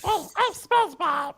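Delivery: SpongeBob impression again. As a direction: another stab at SpongeBob, still off. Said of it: "No, that's not it".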